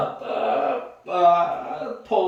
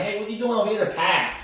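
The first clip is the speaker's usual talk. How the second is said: put-on New England accent; 8 semitones higher than usual. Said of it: "like, New England accent".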